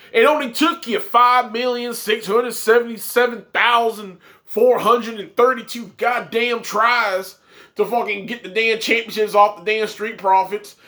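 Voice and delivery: ordinary talk, nothing marked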